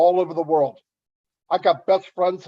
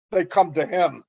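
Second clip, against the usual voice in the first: usual speaking voice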